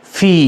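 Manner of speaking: "ফি" with aspirated p; corrected letter name. Shown as a demonstration. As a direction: blow air through "ফি", long ee. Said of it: "এটির উচ্চারণ হবে".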